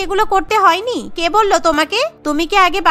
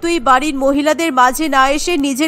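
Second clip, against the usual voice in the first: lower, bossy mother-in-law laying down the rules; stern mother-in-law; 4 semitones lower than usual. Acted. Mother-in-law scolding her son and the new bride, stern and lower. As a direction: scold the son, then hurry the bride along.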